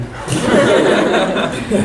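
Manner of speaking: scornful breathy laugh; mocking laugh. Shown as a demonstration. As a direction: laugh down at them. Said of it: "judgmental like".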